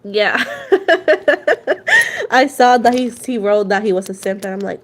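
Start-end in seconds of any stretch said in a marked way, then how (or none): 0.5-2.3 loud, high burst of real laughter; sudden delighted laugh; big laugh. Real, not acted. Laughs at simp comment.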